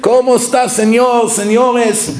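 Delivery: mock a happy Spanish cleaner's welcome; Spanish greeting. Imitating a Spanish casino cleaner.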